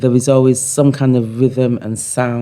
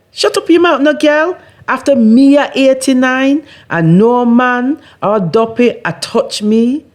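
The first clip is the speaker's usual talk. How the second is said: much higher, louder patois retort; indignant old woman; 13 semitones higher, 5 dB louder than usual. Acted. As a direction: snap back like a proud eighty-nine-year-old. Old woman snaps back in poem.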